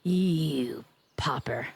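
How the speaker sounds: slow taunting whisper; slow mock-sinister whisper to a pop sayer. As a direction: whisper the insult slowly. Teasing rival in whisper.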